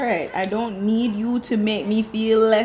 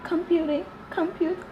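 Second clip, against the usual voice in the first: mocking her voice; 11 semitones higher than usual. Retelling the wife's complaint in a high whiny mock voice. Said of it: "She's like".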